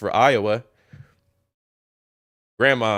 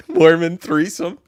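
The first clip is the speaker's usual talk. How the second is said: louder, higher, laughing as it comes out; 6 semitones higher, 7 dB louder than usual. Real, not acted. Reading search terms to viewers, laughing. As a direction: burst out laughing through the words.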